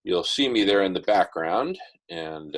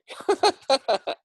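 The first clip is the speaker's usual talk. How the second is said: laughing; 4 dB louder than usual. Real, not acted. Laughing at own remark.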